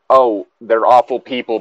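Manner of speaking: usual speaking voice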